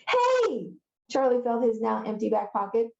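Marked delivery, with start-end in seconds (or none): 0.1-0.7 high, drawn-out protesting "Hey!"; indignant cry. Acted. Charlie finds his pocket picked, crying out indignantly. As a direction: cry out high at being robbed.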